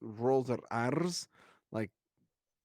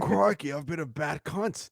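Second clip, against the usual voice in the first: put-on Australian accent; 3 semitones higher, 4 dB louder, slower than usual. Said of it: "Australian accent".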